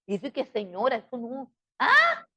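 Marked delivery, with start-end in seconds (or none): 1.8-2.3 high-pitched mocking "huh?"; squeaky, exaggerated question; mock clueless youngster. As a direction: imitate a dim kid, pitched way up. Mocks youngster answering parent's call.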